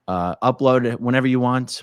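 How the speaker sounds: ordinary talk, nothing marked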